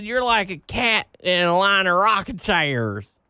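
mock Southern accent. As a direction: stretch it out in a put-on Southern twang. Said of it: "not digging that Southern accent".

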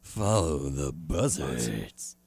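low, very slow creepy whisper; wrestling promo. Retold or imitated. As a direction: drag it out like a cult leader. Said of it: "He's like".

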